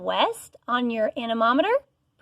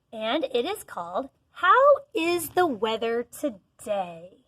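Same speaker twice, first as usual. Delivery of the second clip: usual speaking voice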